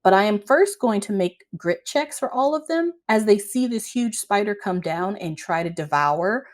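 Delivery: usual speaking voice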